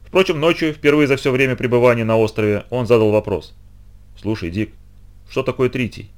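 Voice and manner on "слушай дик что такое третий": hushed night question. Acted. Night whisper in barracks.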